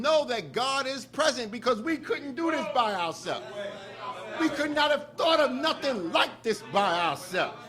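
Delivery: loud, driving sermon voice; preaching. Acted. Minister preaching to the mosque about Farrakhan, loud and rousing. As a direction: build the congregation up, hammering each line.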